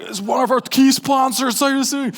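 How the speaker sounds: high, far-off fussy host voice; nervous host. Retold or imitated. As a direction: mimic a flustered event host.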